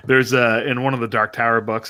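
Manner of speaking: usual speaking voice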